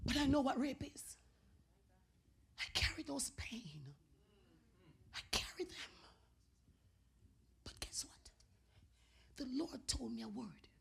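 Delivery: very quiet, pained confession; hushed testimony. Real, not acted. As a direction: say it softly, almost whispering.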